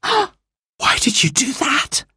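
high, breath-caught, slow question; shocked gasp. A narrator's reading. Jane sees Anthea smash the jug, gasping. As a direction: gasp it out, aghast. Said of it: "gasped Jane".

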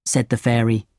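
plain reading voice

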